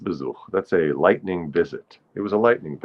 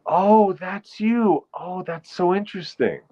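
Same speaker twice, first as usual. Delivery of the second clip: surprised; 12 semitones higher than usual. Real, not acted. Surprised to see a viewer.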